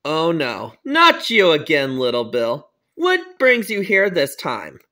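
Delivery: low, slow voice of authority; weary principal. Acted. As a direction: groan it low and slow at a repeat offender. Principal greets repeat offender.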